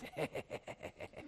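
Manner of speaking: breathy, airy giggle mimicking a friend; imitated laugh. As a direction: copy Paul's quiet breathy laugh. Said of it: "He would always laugh like this".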